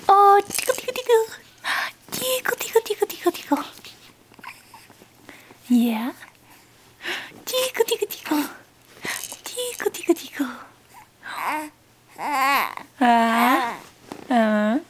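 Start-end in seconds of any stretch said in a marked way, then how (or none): 0.1-3.6 high squeaky playful tickle; giggly tickle attack. Pretend. Tickling the baby. 7.4-10.5 high squeaky playful tickle; giggly tickle attack. Pretend. Tickling the baby again.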